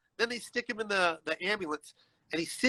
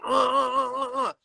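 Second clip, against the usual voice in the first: imitated convulsing, repeated high "uh" sounds; convulsing groan; 7 semitones higher than usual. Retelling how the boy convulsed, imitated convulsing groans. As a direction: stutter out high grunts like a seizure. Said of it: "like, convulsing".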